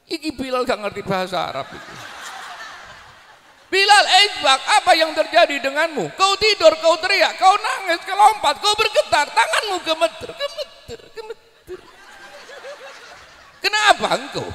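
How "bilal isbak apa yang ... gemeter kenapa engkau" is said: higher, pressing questions from an anxious relative; lightly voiced anxious relative, much higher; worried relative.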